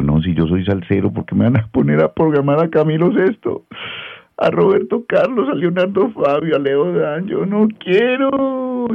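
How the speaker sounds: high, whining mock sob, dragged out at the end; whiny crying protest. Radio host imitating his crying younger self, high and whiny. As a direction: play his young self begging not to program ballads. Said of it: "Yo lloraba".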